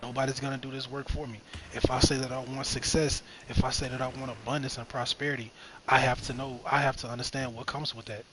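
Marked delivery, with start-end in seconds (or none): none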